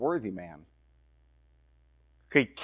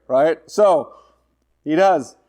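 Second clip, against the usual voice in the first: ordinary delivery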